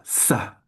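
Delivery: "Sa" with a really sharp, hissed s. Shown as a demonstration. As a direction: demonstrate a crisp, sharp s in "Sa". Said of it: "really sharp".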